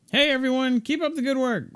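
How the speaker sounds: high-pitched cheery call; calling out cheerily. Acted. Greeting future coworkers at the crime scene, cheery high call.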